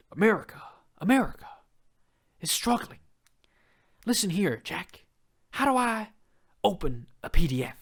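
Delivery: a mock presidential speech that turns into a plea for tech help; Joe Biden impression. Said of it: "That's my best".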